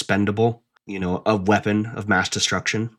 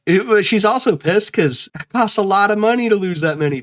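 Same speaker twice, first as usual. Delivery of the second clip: much higher, louder mock exasperation; mock outrage; 11 semitones higher, 3 dB louder than usual. Myers fuming about lost money, much higher and louder. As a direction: rant like a stingy boss.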